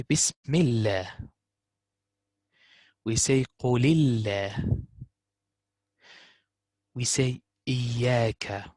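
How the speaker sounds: slow, careful model words with a light alif; slow clear recitation. Shown as a demonstration. Tutor to student, slowly modelling each word.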